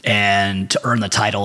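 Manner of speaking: usual speaking voice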